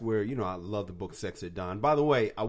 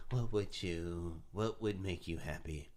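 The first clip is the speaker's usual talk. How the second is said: careful soft ask; 3 semitones lower, 6 dB quieter, slower than usual. Retold or imitated. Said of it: "So I was like".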